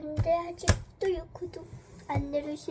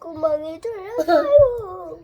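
mock crying; 9 dB louder, slower than usual. Slime bursts, cries out.

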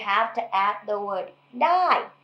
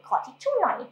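"ขอทิชชู่หน่อย" asked gently, a touch higher; polite request; 6 semitones higher, much faster than usual. Shown as a demonstration. Teacher demonstrating a polite request. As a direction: soften it into a polite ask. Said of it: "That's a lot politer".

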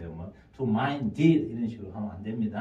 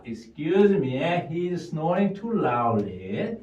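slow model sentence; 4 semitones higher, much slower than usual. Shown as a demonstration. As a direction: read it out slowly for the class. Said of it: "다시요". (천천히 또박또박 읽는 예문)

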